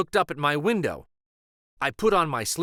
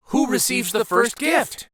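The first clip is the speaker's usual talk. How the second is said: put-on eager children asking; 8 semitones higher, 6 dB louder than usual. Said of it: "we all asked".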